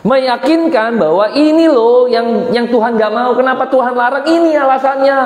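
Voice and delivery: high-pitched, emphatic tempter's pitch; insistent devil persuading. Acted. Devil convincing Eve of God's reason for forbidding, insistent. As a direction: push it high and urgent, desperate to convince. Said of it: "iblis mencoba meyakinkan itu".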